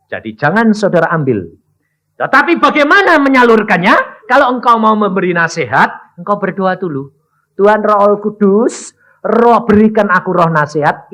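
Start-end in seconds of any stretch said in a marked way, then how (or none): 2.2-11.1 fiery preaching. Acted. Preacher crying out to the church about understanding. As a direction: preach it out to the congregation.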